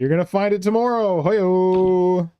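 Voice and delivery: loud, high, gleeful taunt; excited teasing shout. Real, not acted. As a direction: crow it out at her. Teasing her about lost blade.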